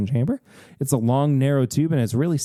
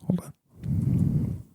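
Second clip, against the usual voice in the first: whispering; 2 semitones higher, 5 dB quieter than usual. Acted. Whispered aside.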